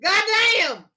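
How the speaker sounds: high, loud hammy shout; mock angry yell. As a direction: bellow it like a riled-up fan. Yelling to get in the element.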